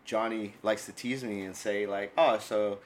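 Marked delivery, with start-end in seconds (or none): none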